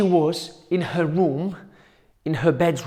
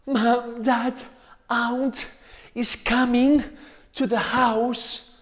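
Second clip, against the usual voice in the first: put-on higher, urgent scared girl calling her parents; a touch of panic, telling them fast; frightened warning; 7 semitones higher, slower than usual. Caterineta warning Mom and Dad the aunt is coming, higher and frightened.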